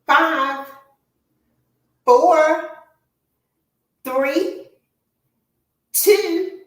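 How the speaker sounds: high, loud call-outs of each count; coach counting reps. Real, not acted. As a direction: pump the class up with every number.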